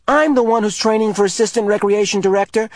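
high, loud indignant voice; angry. A narrator's reading. Asher angrily telling Jonas that games are his area. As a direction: assert your authority hotly. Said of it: "Asher pointed out angrily".

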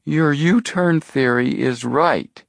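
high, slow character voice; high-voiced, slow interjection; interrupting sceptic. A narrator's reading. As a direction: draw out a doubtful objection in a high voice. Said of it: "Chet cut in".

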